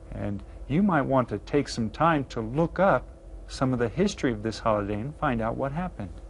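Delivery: normal talking voice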